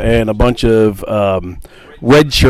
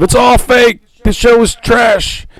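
high-pitched imitated protest; hammed-up high yell; mock heckling shout; 10 semitones higher, slower than usual. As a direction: call it out like a heckler, drawing out the last words. Acts out Jim rallying the others.

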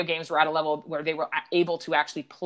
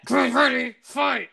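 high, loud arcade announcer call; booming fighting game announcer; 7 semitones higher, 6 dB louder, slower than usual. Retold or imitated. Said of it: "how low quality it is".

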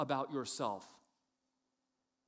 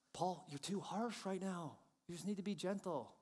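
soft, higher imitation of a wife; wife's gentle reproach; 4 semitones higher, 5 dB quieter than usual. Wife tells preacher he is too harsh, softly. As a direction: chide softly, taking your time. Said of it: "Jenny confronting me".

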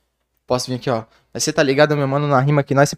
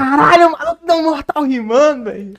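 excited; 12 semitones higher than usual. Real, not acted. Excited memory of a backflip.

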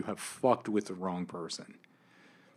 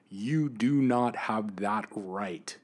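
normal talking voice

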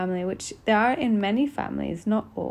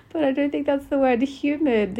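laughing, higher and louder; amused; 7 semitones higher, 7 dB louder than usual. Real, not acted. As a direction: giggle through the correction. Laughing at her own word choice.

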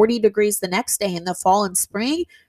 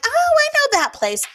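much higher "oh" of sudden recognition; 3 dB louder than usual. Retold or imitated. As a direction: pitch it way up, like a penny dropping. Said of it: "They're like".

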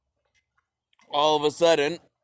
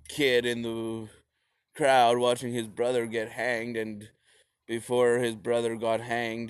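ordinary talk

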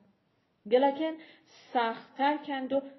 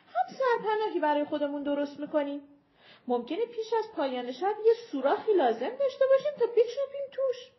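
higher, fast and pressing, a hint of strain; urgent companion; 6 semitones higher than usual. Companion urging the tired group to dig in, hurried. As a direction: push the others to start digging now. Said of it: "گفت".

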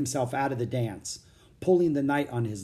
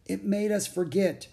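dazed knight; 8 semitones higher than usual. A narrator's reading. Dazed knight recalls the dance.

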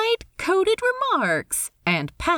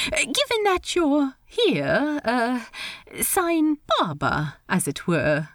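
high, lilting, polite voice; awkward host in a high register; Steed, politely awkward; 5 semitones higher than usual. Acted. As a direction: pitch it high, hesitate politely. Steed advises Ed.

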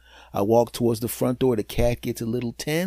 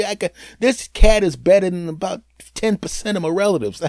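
normal talking voice

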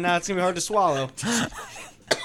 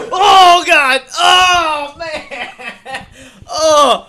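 loud, high cries of disgust; disgusted shouts; 13 semitones higher, 14 dB louder, much slower than usual. Real, not acted. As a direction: yell it out like you can't watch. Watching friend nearly puke.

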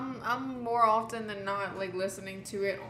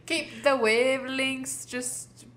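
bad mock Irish accent; 6 dB louder, much slower than usual. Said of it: "on a bad accent".

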